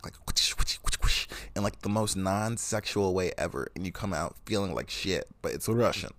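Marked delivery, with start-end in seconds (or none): 0.3-1.3 whispery rushing whooshes; imitated branch smacks. Describing branches smacking your back in a spa, imitated whooshes. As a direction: mimic branches swishing through the air. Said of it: "like, smack your back".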